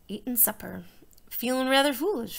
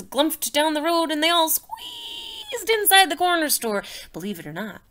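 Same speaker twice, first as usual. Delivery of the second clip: plain reading voice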